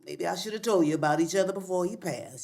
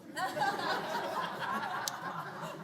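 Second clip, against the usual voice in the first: mocking cackle; 8 semitones higher, 10 dB quieter than usual.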